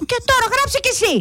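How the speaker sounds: high, rapid outburst; worked up. Acted. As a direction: snap it out high in mock anger. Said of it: "Θα φωνάζω". (ψηλό, νευριασμένο ξέσπασμα)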